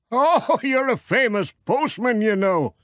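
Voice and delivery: a farmer playfully hinting at the secret; Alf Thompson. Acted. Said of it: "said Alf, smiling".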